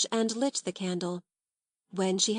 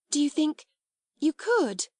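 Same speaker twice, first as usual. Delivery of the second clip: startled exclamation; 7 semitones higher, 3 dB louder than usual. A narrator's reading. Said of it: "she ejaculated".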